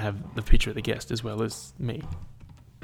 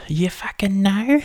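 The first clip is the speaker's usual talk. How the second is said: much higher, slow, drawn-out squeal; high mock squeal; 10 semitones higher, 4 dB louder, slower than usual. Real, not acted. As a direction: pitch it way up and stretch it. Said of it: "You know?".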